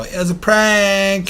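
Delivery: slow high put-on announcement; hammy slow mock reveal; mock corporate voice. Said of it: "Konami come out and go".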